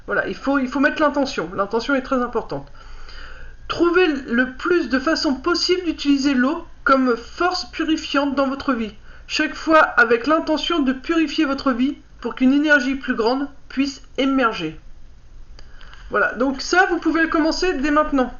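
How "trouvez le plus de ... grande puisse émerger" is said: lire le livret posément, un peu plus haut que la parole; lecture à voix haute. Acted. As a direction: read out a card's message clearly, phrase by phrase. Reads card booklet aloud. (lecture appliquée à voix haute)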